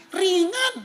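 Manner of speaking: high, loud "Ringan."; light. Retold or imitated. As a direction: lift "Ringan." high and bright with relief. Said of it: "Terus dia bilang gini". ("Ringan." diucapkan tinggi dan keras)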